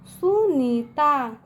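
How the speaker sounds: सुनीता very slowly, each syllable held; syllable-by-syllable reading. Shown as a demonstration. Teacher answers how to read it, सुनीता slow and drawn out. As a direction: stretch सुनीता as सु, नी, ता. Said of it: "कैसे पढ़ेंगे इसको?".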